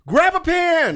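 mock frantic order. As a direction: bark it out like a panicking man. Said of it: "he said".

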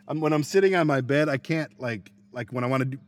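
normal talking voice

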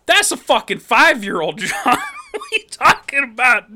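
loud, high-pitched disbelief; mock incredulous. [name] challenging John's claim about his dad, mock incredulous.

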